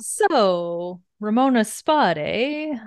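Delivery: imitating a pushy board man, hammed-up; smarmy pitch. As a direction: sell her the seat, all charm. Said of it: "And he's like".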